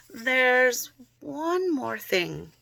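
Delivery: slow, deliberate father voice; a hint of a grave father clearing the air; stern father. A narrator's reading. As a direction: draw it out like a serious dad. Father's serious announcement voiced.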